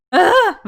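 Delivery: loud, high burst of dread; sudden hammed-up "ugh"; mock panicked groan. Vlogger reacting to how many watch the videos, mock panicked groan.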